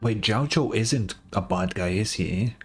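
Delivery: ordinary talk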